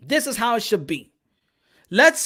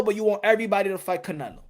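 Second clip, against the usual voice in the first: usual speaking voice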